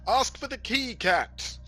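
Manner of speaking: loud, unsubtle shout. Acted. Player loudly telling a friend to ask for the key, loud shout. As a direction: shout it out with no subtlety at all. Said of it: "shout out very loudly and not at all subtly".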